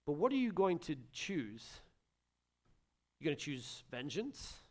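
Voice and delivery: ordinary talk, nothing marked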